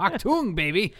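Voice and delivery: a quick high mock German bark. Host joking about German accent, light mock German. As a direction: toss off a hammed-up German word. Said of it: "try your German accent".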